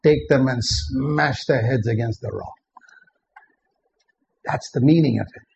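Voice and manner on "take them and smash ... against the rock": normal talking voice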